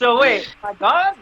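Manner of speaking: high, loud alarmed question. Retold or imitated. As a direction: realize you may be written out. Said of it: "You know, like".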